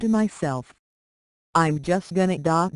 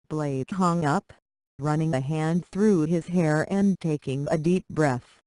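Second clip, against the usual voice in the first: ordinary delivery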